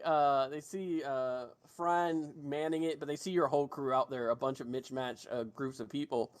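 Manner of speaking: ordinary delivery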